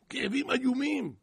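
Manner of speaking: loud, high cry falling at the end; mock agonised cry. Preacher voices bitten Pharaoh's pains, mock agonised cry. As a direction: wail it out in hammed-up pain.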